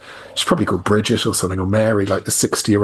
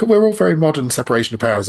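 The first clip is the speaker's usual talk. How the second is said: higher-pitched mock politician's quote; mock Blair; 7 semitones higher, faster than usual. Irish guest mimicking Blair coming in, higher mocking voice. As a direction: start high on a mocking quote, then come down. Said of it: "Blair came in and went like".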